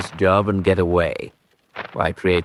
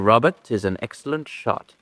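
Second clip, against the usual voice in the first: ordinary talk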